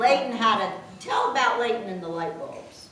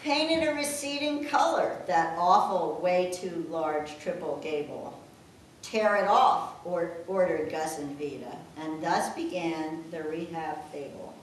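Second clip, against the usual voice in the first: poem reading; 5 semitones lower, 4 dB quieter than usual. Acted.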